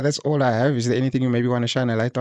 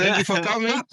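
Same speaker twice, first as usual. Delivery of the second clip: higher, giggling through it; can't hold it together; laughing; 11 semitones higher than usual. Real, not acted. Laughing at himself.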